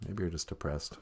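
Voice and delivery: an inner voice replying to the depressed remark; deep inner voice. Pretend. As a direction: answer yourself from deep down. Other voice answers.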